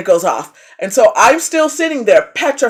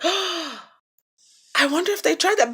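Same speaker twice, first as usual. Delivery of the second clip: soft, slow, higher and quieter curious voice; 8 semitones higher, 8 dB quieter, slower than usual. Retold or imitated.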